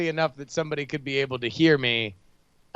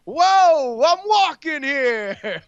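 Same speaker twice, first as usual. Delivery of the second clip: high, drawn-out New Yorker holler; hammed-up New York street accent; mock New Yorker accent; 10 semitones higher, 5 dB louder, much slower than usual. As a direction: shout back like an indignant New Yorker. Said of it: "communicating in their native language".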